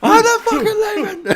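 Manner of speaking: high laughing yell; mock defiant chant; laughing shout. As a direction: cry it out through laughter.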